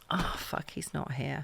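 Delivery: whispered, dropping quiet oh fuck; dismayed whisper. Retold or imitated. Arriving at the pillars, finding him gone, a whispered dismay. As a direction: breathe it out in dismay.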